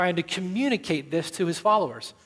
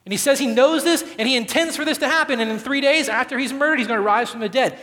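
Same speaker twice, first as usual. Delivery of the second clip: higher, louder and fast, surging; building fervor; 5 semitones higher, 5 dB louder than usual. Real, not acted. Preacher listing what Jesus foretold, building to a fast climax. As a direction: let it rush out in a rising surge.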